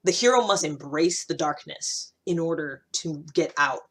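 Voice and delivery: normal talking voice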